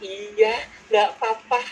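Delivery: high-pitched, meek-sounding agreement as an acted type; orang S yang pasrah. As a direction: put on a high voice for a compliant pushover. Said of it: "Orang S bilang begini". (suara orang S yang pasrah, bernada tinggi)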